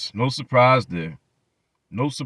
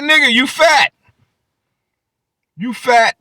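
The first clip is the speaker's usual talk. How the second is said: mocking; 10 semitones higher, 5 dB louder than usual. Real, not acted. Mocking reply to Angry Man.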